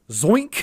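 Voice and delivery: high, loud "zoink"; zoink sound effect. Retold or imitated. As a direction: punch it out like a launch noise. Imitates being suddenly launched away.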